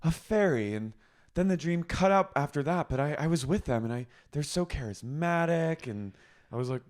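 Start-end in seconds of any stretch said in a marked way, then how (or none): 0.0-5.8 higher, gushing mock Charlotte; dreamy Charlotte. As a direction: swoon over them, lightly voiced. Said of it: "she goes".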